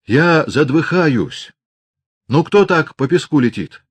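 slow, panting after hurrying; puffing between words from the rush; out of breath. A narrator's reading. Aksinya, out of breath from rushing to Grigory, panting. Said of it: "запыхалась".

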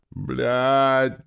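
high, loud moaned curse; plaintive moan. A narrator's reading. Injured man dragged by his legs, moaning plaintively. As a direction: groan it out in pain, pitifully. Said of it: "простонал я жалобно".

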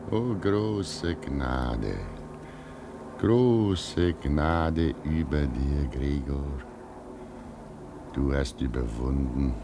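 put-on old man blessing the young man's sacrifice; solemn blessing.

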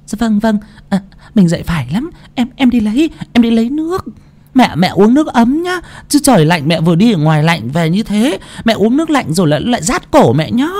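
high, quick eager obliging; high quick fawning; eager wife. A narrator's reading. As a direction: rush to please in a high voice. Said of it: "Mụ Dương mau mải".

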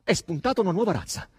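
loud, high indignation; angry, scornful outburst; furious. A narrator's reading. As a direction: fling it out in outrage. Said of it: "andò su tutte le furie".

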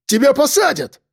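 very high, frantic warning; crying out. A narrator's reading. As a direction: shriek it as a desperate threat. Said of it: "выкрикнул он".

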